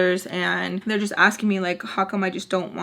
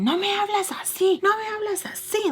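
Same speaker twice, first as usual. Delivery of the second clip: much higher imitating voice, scolding in Spanish; mock stepdad scolding; 12 semitones higher than usual. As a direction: mimic him scolding her. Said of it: "he starts saying".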